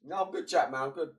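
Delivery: ordinary talk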